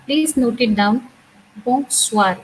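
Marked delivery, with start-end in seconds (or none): 1.7-2.4 "bonsoir" with a nasal bon and a clear swar. Shown as a demonstration. Teacher showing the class the nasal sound in "bonsoir". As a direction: hum the bon through the nose, then open up on soir. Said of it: "It has a nasal sound again".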